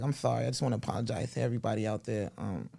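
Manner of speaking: soft, low apologetic impression; Mike Tyson. Mike apologizing to everybody out there, hushed apology. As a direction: apologize quietly, fading almost to nothing. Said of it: "he apologized to him".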